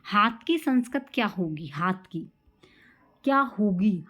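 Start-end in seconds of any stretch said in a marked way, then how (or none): none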